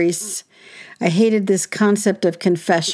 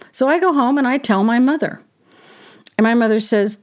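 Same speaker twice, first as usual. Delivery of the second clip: ordinary talk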